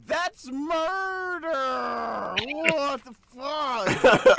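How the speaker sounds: slow, drawn-out horrified shout; outraged yell. Real, not acted. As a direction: stretch every word in shock. Character kills someone in game.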